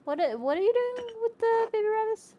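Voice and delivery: high, loud horrified cry; a high, loud, appalled yelp; alarmed squeal. Real, not acted. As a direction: shriek it in shocked disbelief. Repeats rabbit question in alarm.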